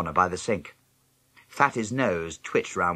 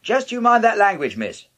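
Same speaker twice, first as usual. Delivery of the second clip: loud, high warning from Mum; shouting up the stairs; 13 semitones higher, 6 dB louder than usual. A narrator's reading. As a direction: call up the stairs, scolding loudly. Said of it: "Mum shouted up the stairs".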